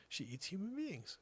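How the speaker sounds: ordinary talk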